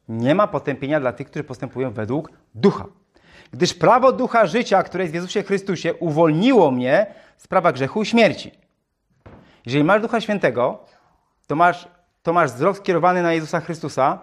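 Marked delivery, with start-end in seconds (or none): 3.5-8.5 raised, formal Bible reading voice; scripture reading. Acted. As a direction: lift the verse above the sermon. Reads scripture aloud. (podniosłe czytanie Pisma)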